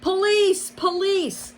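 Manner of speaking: high, loud shouting; yelling for police. A narrator's reading. Fox yelling for police over the unpaid bill, high and loud. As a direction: call out for the police. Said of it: "He started yelling".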